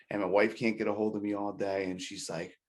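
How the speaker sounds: ordinary talk, nothing marked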